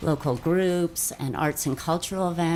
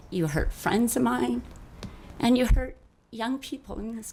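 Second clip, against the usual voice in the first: higher, voice straining with hurt; emotional; 5 semitones higher than usual. Real, not acted. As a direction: speak through real hurt, pitch climbing. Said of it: "I'm gonna get emotional".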